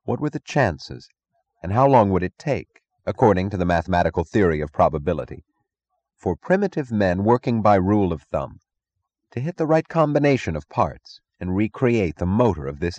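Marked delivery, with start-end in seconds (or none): none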